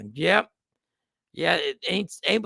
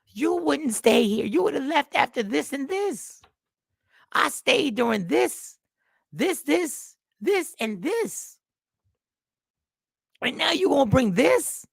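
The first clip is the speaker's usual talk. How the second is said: much higher, slow, incredulous woman's complaint; exasperated woman; 9 semitones higher, slower than usual. Retold or imitated. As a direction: drag out each this in disbelief. Voiced woman's grievance continues.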